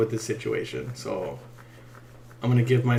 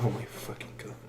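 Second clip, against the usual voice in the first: breathy whispered inner groan; whispered exasperation; 4 semitones lower, 15 dB quieter than usual. Retold or imitated. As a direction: say it like a thought to yourself. Inner reaction to partner.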